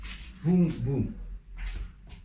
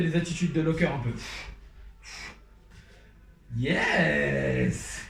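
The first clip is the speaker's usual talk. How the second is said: usual speaking voice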